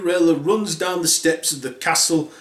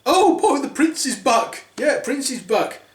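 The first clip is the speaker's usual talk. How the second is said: high giddy squeal; excited stepsisters; 9 semitones higher than usual. Acted. Stepsisters squealing that the prince is back. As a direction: squeal with glee. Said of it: "The stepsisters were like".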